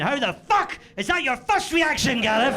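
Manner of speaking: high, loud scandalised partner; mock outraged wife. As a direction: voice the wife's disbelief, hammed up. Wife voiced, outraged at his question.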